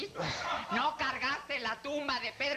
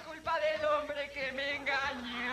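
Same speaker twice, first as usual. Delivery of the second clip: melodramatic lament; 6 semitones higher than usual. Acted. Old actress declaims her tragic lament to the boss, hammed-up wail. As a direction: declaim it like a heartbroken telenovela heroine.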